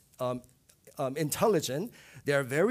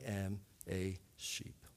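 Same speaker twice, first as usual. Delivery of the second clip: whispered; 6 semitones lower, 9 dB quieter, slower than usual. Real, not acted. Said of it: "humbling admission".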